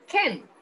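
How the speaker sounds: "Can" short, without the T. Shown as a demonstration. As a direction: model "Can" with no T. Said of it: "Así, mira, así".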